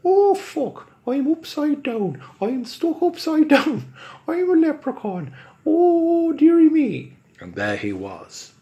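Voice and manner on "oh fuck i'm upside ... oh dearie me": high, loud stage Irish squeal; leprechaun Irish accent. Acted. Upside-down leprechaun complaining in thick Irish accent. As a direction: wail it like a stuck leprechaun. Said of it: "back into character then".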